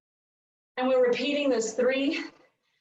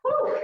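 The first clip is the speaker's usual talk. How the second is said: relieved exhale; 12 semitones higher than usual. Real, not acted. Exhaling after hard interval.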